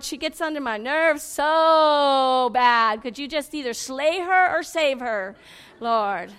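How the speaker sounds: loud, drawn-out wail of a pray-er; hammed-up plea, words stretched slow; mock pleading prayer. Woman praying all talk to God, mock pleading.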